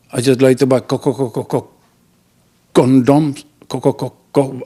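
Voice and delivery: stuck stutter on co- over and over; can't get the word out, stutter it; stammering. Acted. Embarrassed customer asking the teller for condoms, stuttering.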